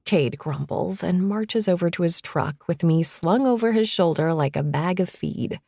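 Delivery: plain reading voice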